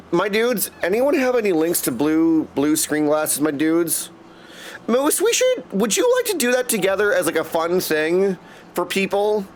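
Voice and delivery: normal talking voice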